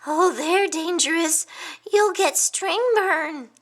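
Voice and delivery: high anxious warning, slow on string burn; fretful little hedgehog. A narrator's reading. Hedgehog warning his friend about kites, high fretful voice. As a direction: fuss like a worried little hedgehog.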